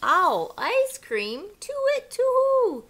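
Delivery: high, loud panicked hoot; frightened owl. A narrator's reading. Owl hearing it is Gruffalo food, panicked high hooting. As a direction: owl shrieks in fright before fleeing. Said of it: "and away owl flew".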